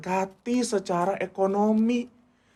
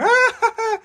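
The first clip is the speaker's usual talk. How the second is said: gleeful mock laugh; 5 dB louder than usual. Mock kid laughing at parents.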